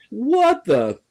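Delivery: high-pitched exaggerated bafflement; mock disbelief. Reacting to a theatrical release, hammed-up disbelief. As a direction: shoot the pitch up in mock outrage.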